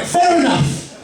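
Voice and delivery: high resigned. Retold or imitated. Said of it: "I'm like".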